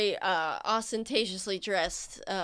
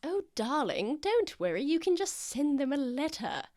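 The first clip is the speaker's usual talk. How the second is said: higher, cooing noble lady; doting noble lady; 6 semitones higher than usual. Acted. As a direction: soothe her like a fussy aristocrat. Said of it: "Lady Gwynth voiced".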